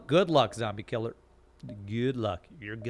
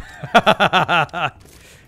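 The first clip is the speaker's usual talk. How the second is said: big amused laugh, louder; 5 semitones higher, 10 dB louder than usual. Real, not acted. Streamer reading a funny chat message, laughing out loud. Chat calls it bee wasp documentary.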